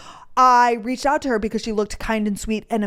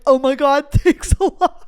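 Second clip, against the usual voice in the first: louder, laughing mock thanks; laughing sarcasm; 4 semitones higher, 7 dB louder than usual. Answering a follower's IUD warning, laughing sarcastically. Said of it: "I'm like".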